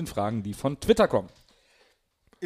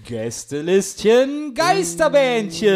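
announcer voice; 7 semitones higher, 3 dB louder, much slower than usual. Acted. Show name announced slowly.

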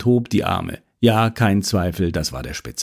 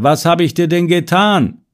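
higher and a bit louder, pressing the question; the plea starts to swell; mounting, insistent question; rising louder; 6 semitones higher than usual. Acted. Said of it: "Und dann lauter und lauter".

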